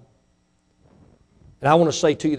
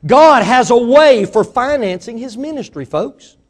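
slow, higher, louder preacher's cry; preaching shout; 9 semitones higher, 9 dB louder, slower than usual. Real, not acted. Preacher crying out to his congregation, slow and loud. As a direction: hammer the line home from the pulpit.

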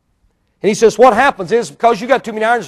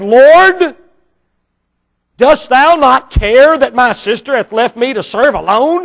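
a lightly voiced, raised plea from Martha; mock-plaintive Martha, pitched higher; Martha's complaint; 4 semitones higher, 5 dB louder, slower than usual. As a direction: whine it up to the Lord. Martha complaining in scripture reading.

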